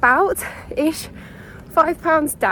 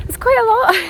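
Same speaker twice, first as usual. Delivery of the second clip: laughing; 10 semitones higher, 3 dB louder than usual. Real, not acted. Laughing at her own week.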